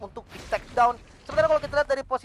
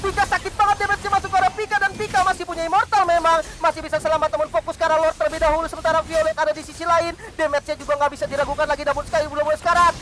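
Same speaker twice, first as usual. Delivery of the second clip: high, loud, nonstop commentary; excited play-by-play; 8 semitones higher, 4 dB louder than usual. Real, not acted. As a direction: keep the hype up the whole way. Caster calling the final fight.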